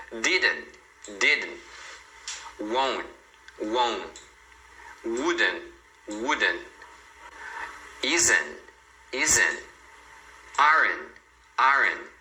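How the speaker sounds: didn't, won't, wouldn't, isn't, aren't ending on N; dropped final T. Shown as a demonstration. Teacher listing negatives for learners, didn't without final T. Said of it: "muito sem o T".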